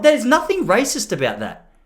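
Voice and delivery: ordinary talk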